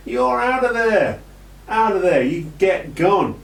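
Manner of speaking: high, punchy call-out; mock dismissal call. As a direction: throw them out like an announcer calling an elimination.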